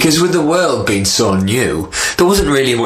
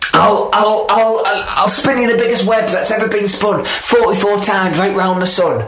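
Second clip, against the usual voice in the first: spider; 11 semitones higher than usual. Acted. Spider offers a web.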